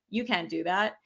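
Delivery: offhand imitation of a dismissal; dismissive. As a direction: drop the voice and wave them off. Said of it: "It was the dismissal".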